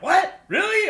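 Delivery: high, loud disbelieving squeal; mock shock. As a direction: act floored by the answer. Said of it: "I'm like".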